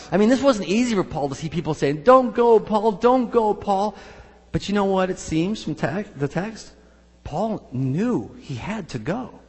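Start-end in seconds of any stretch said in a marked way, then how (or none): 2.0-3.9 loud, high pleading cry. Retold or imitated. Friends begging Paul not to go to Jerusalem, loud high plea. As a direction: beg him not to leave, calling out.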